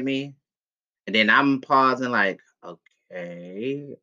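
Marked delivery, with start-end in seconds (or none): 2.6-4.0 breathy aside, barely voiced; awkward whisper. Facing her stunned mom, an awkward whispered aside. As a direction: whisper it under your breath, stalling.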